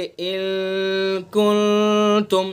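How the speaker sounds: इन कुंतुम slow and long, the n hidden in the nose; nasal ikhfa. Shown as a demonstration. Teacher showing the right nasal reading, slow recitation. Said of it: "बल्कि नाक के बासे से अदा करेंगे".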